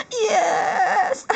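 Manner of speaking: long, squealed yes; excited squeal. Real, not acted. Collector spotting a favorite in the pack, excited squeal. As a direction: burst out with a drawn-out squeal of joy. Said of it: "Sorry for my voice again".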